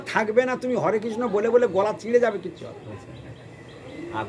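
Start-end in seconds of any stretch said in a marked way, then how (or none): 2.4-4.2 slow whispered warning; whispered aside. Real, not acted. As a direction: drop to a breathy, slow whisper. Dismissive aside to listeners. (ধীরে ফিসফিস করে সতর্কবাণী)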